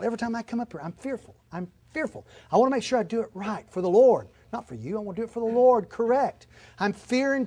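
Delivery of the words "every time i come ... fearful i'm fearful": quiet confession. Real, not acted. Confessing fear.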